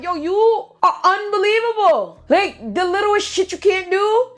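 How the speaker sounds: high, slow, outraged; going off. Retold or imitated. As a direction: retell how you blew up at him. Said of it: "I was going off".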